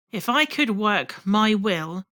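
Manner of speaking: plain reading voice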